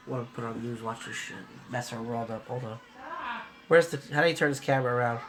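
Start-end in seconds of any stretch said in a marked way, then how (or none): 0.1-2.8 softer, lower conspiratorial aside. Real, not acted. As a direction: plot it quietly to the viewers. Plotting to film his wife.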